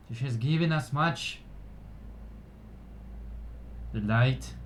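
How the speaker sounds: measured ceremonial address to a gathering; solemn, projected speech of thanks; elf orator. Acted. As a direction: proclaim it to your people with pauses.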